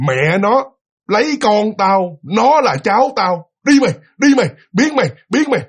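furious, loud chasing-off yell; high, loud angry shout. Acted. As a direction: drive him away, shouting. Woman chases the spirit off.

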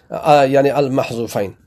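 ordinary talk

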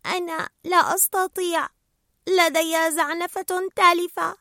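high, plaintive little voice; helpless young fish. A narrator's reading. Nemo tells Gill his fin is damaged, high helpless protest. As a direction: whine it up high, slowing toward the end.

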